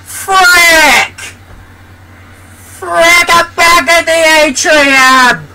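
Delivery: very high, frustrated cry; exasperated wail. Real, not acted. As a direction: wail in high-pitched dismay. Game throws player back to atrium.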